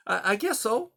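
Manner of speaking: small fox unsure; high young fox voice. Acted.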